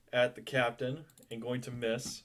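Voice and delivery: ordinary talk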